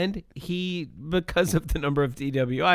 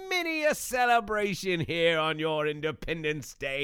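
put-on shitty Australian accent. Wallaby Wanker greeting podcast viewers in a hammed-up Australian accent. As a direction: play a cheesy Aussie safety mascot. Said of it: "your shitty Australian accent".

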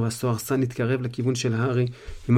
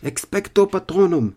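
loud, high shouted incantation; roaring spell; 7 semitones higher, 6 dB louder than usual. Acted. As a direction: roar the spell. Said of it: "שאג הארי".

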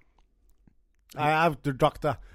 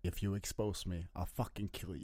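low, much softer threatening voice; quiet lowered menace; menacing threat; 10 semitones lower, 17 dB quieter than usual. Acted. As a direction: threaten him in a dark low murmur. Doctor threatens patient in menace.

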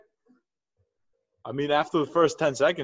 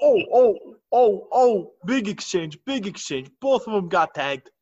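excited; 5 semitones higher, 4 dB louder than usual. Real, not acted. Big exchange in the fight.

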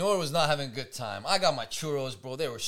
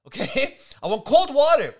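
louder, higher mock rage with a laugh; mock angry yell; 6 semitones higher, 8 dB louder than usual. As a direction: ham up her furious demand for cold water. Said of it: "She's like".

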